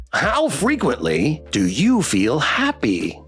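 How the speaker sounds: slow, sing-song robotic question; measured, swooping plush pet voice; over-enunciate like a cheerful questionnaire robot; survey-bot toy pet. Acted.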